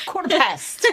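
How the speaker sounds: playful posh British on "Quarter past"; lightly hammed-up British accent; mock British. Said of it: "Yes, like that".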